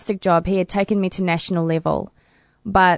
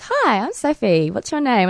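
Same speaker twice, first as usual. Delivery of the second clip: Sophie; 5 semitones higher than usual. Retold or imitated. Swimmer imitating her idol introducing herself, breezy hello. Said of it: "she goes".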